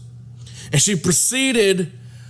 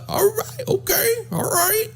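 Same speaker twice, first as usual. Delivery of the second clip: very high, softer, near-crying agreement; whiny high voice; 4 dB quieter than usual. Retold or imitated. Nervous man going along with the plan, near tears. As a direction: squeak it out like he's about to cry. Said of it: "Doug was like".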